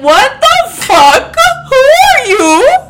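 high, loud and slow, with a crying edge; shrieking at a stranger in the back seat; mock scream. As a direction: scream it like finding a stranger in the car. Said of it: "I would be like".

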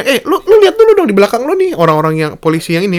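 very high, urgent calling out; alarmed bystander. Retold or imitated. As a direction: warn the cop to look behind him. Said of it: "orang malah mikir ya".

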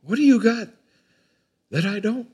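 high, loud, mocking pal's voice; bad grammar. Mocking a pal's bad grammar, high and loud. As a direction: quote the little pal in bad English. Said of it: "Proper English is".

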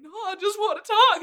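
high, loud imitation of a plea; retold protest. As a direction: blurt it out as the misunderstood cowboy. Retold cowboy pleading with Heather.

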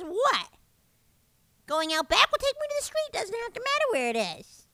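high breezy mom impression; imitating mom. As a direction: play a mother casually asking for the end. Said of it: "She says".

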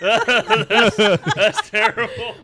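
laughing through the words; giggling while saying it. Real, not acted. Laughing after tasting vomit flavor.

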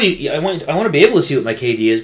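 usual speaking voice

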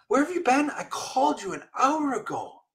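high-pitched imitation of a yelling lady; high, mock-indignant holler. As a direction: retell her complaint in a very high voice. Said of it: "Why are you yelling at me".

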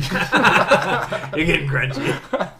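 slow, high, laughing; laughing tease. Acted. Teasing friend about the sandwich, slow laughing. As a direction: drag it out through a laugh.

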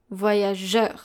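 "voyageur" said in French, as vwa-ya-zhuhr; French pronunciation. Shown as a demonstration. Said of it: "followed by a U".

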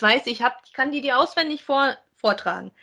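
ordinary talk

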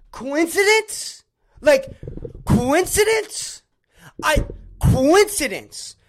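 loud, slow sarcastic disbelief; incredulous sarcasm. Real, not acted. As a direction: stretch each word in mock disbelief. Sarcastic questioning of coincidence.

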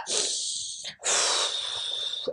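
imitating a child's long, slow breath out; deep breath. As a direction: blow out a big calming breath, as a small child would. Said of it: "did his deep breathings".

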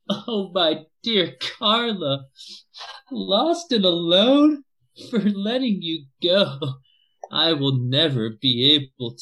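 high, slow, loud lament, hammed-up; grieving father's poem. A grieving father recites a poem for his missing daughter, hammed-up lament. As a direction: wail the verse like a mournful bard. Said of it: "enough of your poems".

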